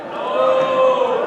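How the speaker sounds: hello swooped very high; sing-song hello. Shown as a demonstration. Performer greeting the audience, high sing-song. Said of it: "greeting the crowd".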